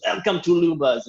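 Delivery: a showy welcome in a put-on voice; Luba's voice. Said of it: "And she goes".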